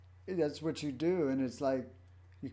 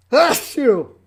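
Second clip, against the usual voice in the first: booming high exclamation; loud exclamation; 12 semitones higher, 20 dB louder than usual. Real, not acted. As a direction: belt it out suddenly. Loud self-blessing after a sneeze.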